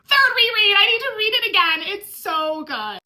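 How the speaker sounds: real tears welling up, pushing through; choking up. Real, not acted. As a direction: voice breaking high and loud, near tears. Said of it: "Since I'm already almost crying".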